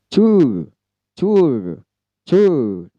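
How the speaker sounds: American tour, clear R. Shown as a demonstration. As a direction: hit the R in tour hard. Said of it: "U dan R saja".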